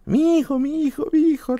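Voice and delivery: very high, wailing mock sob; mother crying. Retelling mom's wail over her son, high mock sob. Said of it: "también llorando".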